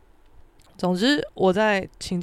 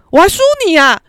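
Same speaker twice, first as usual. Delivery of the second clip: loud, high scornful disbelief; classless, indignant; 8 semitones higher, 11 dB louder, faster than usual. Retold or imitated. Student to top-ranked classmate, rudely indignant. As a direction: throw it at a rival as an insult. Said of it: "我超没水准".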